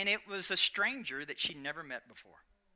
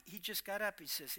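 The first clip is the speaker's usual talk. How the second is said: ordinary talk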